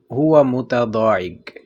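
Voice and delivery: slow, careful, falling at the end; slow demo. Shown as a demonstration. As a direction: model it slowly. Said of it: "اگر آپ نے کہنا ہے". (آہستہ مثال)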